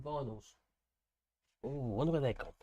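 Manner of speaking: ordinary talk, nothing marked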